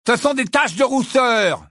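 loud, high, exasperated retort; furious. A narrator's reading. Ron corrects the healer about his freckles, furious. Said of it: "répliqua Ron d'un ton furieux".

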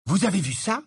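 high-pitched excited question; enthusiastic cry. A narrator's reading. Harry turning to Ron and Hermione, enthusiastic. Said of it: "s'écria Harry enthousiaste".

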